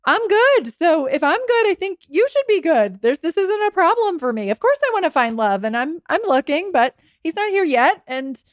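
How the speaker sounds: high, sweetly assured tone; self-assured. Acted. Single person telling a pitier they are good, self-assured. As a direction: reassure them as if they need comforting. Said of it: "tone and energy will come through".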